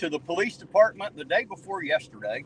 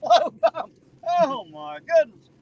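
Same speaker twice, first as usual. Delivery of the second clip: mocking laugh; 12 semitones higher, 6 dB louder, slower than usual. Real, not acted. Mocking the new sign.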